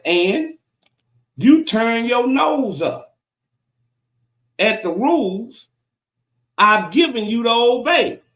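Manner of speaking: slow, loud scripture proclamation; slow, emphatic pulpit reading; preaching God's rebuke. Acted. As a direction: punch out each phrase, pausing between.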